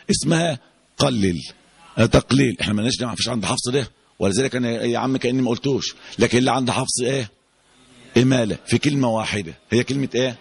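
ordinary talk

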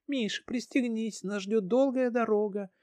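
put-on high child's voice; excited little girl. Daughter talks to teddy.

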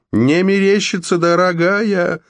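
very high, stretched-out call; high yell. A narrator's reading. Froggy answers Red from up the stalk, yelling. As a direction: call down in a high voice, drawing out the end. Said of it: "заорал Фрогги".

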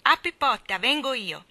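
put-on high, loud child's shout; little boy calling from behind the door; impatient little voice. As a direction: call out like an impatient toddler. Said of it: "una vocina impaziente gridò".

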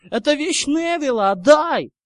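high-pitched, loud demand; shout. A narrator's reading. Ernie demanding Neville's thing back, shouting. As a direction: yell it, rising to a burst. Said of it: "крикнул Эрни".

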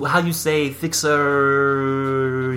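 slow, hammed-up fake French; French accent. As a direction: drawl it like a bad French speaker. Mock French wife, slow.

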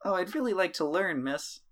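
put-on high, eager young boy; a bright, wide-eyed kid asking. NPC kid speaks to Dolores.

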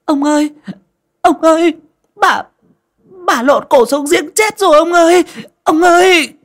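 very high, loud terrified screaming; la hét hoảng loạn; panicked shriek. Acted. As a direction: scream for the master in horror. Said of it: "nó la lên oái oái".

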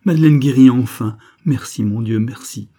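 s'exclamer fort, en rendant grâce; exclamation dramatique. A narrator's reading. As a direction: jouer la joie, mains jointes, plus fort. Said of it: "son exclamation dramatique". (comte au notaire, exclamation de joie forcée et bruyante)

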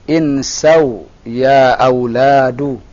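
very slow, drawn-out Arabic model phrase; slow model reading. Shown as a demonstration. Teacher showing the class the plural form, slow model reading. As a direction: draw out each long vowel slowly. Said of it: "Tambahan waw dan alif".

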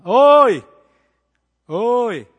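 loud high hey-you call; mock yell. Busan people calling out without names, loud. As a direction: shout it across the street. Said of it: "거의 이름 못 들었습니다".

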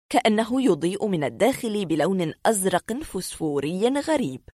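ordinary delivery

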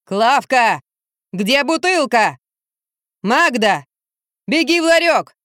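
acted yell, climbing high at the end; drunken bellowing. A narrator's reading. Drunk man hollers at his household for a bottle, bellowing. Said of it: "и заорал".

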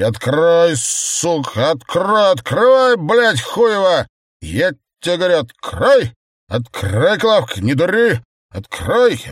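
high, loud swearing rage; furious drunken shouting. Acted. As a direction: scream threats, beating the door. Drunk man raging, pounding the door. (яростный пьяный ор)

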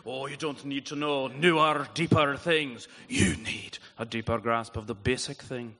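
hammed-up Scottish accent, loud and rolling. Pastor imitating his Scottish former pastor for the congregation, broad Scottish accent. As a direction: imitate a wise Scot with a thick put-on accent. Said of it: "he said it in his wicked cool Scottish accent".

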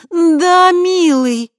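high, loud, breathed "darling"; breathy seductive. A narrator's reading. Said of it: "выдохнула Криста".

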